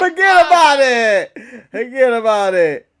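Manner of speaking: slow, hammed-up New York Italian mobster drawl; mock Italian-American mobster. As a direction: toss it off like a playful New York Italian wiseguy. Mimicking Tony D'Angelo's mobster catchphrase.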